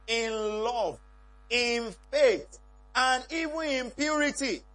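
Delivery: loud, slow, emphatic preaching. Acted. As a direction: proclaim it from the pulpit. Preaching the verse at full force.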